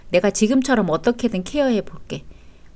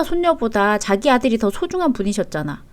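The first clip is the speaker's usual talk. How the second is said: ordinary delivery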